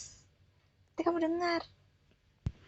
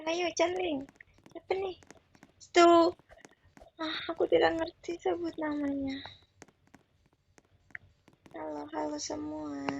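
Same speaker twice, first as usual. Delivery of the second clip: normal talking voice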